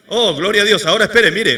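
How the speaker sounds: loud glory exclamation; preacher bursting out in praise, loud. Real, not acted. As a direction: call out praise to God.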